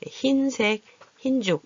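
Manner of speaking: 흰색, 흰죽 with 흰 sounded as hin; modelled pronunciation. Shown as a demonstration. Teacher shows how 흰색 and 흰죽 sound, clearly modelled. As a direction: say 흰색 and 흰죽 with a simple i. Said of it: "이렇게 소리가 나요".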